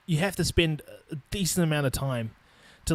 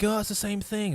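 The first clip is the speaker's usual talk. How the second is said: higher-pitched, lightly mocking quote; mock naive people; 6 semitones higher than usual. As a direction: lightly imitate someone brushing it off. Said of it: "people are like".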